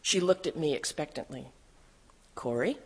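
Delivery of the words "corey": questioning name, rising at the end; gentle prodding. A narrator's reading. Sister waiting for her sister to pray, gentle prodding. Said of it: "she prodded".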